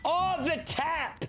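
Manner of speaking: high, slow drawn-out oh; sudden happy realisation; delighted recognition. Real, not acted. Streamer recalling school slang, high delighted surprise.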